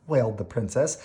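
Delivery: plain reading voice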